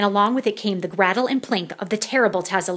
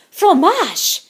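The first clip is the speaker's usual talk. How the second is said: high, loud proud cry; eager answer; 9 semitones higher, 9 dB louder than usual. A narrator's reading. Poodles showing off their French, high and loud. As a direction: shout it out like a keen pupil.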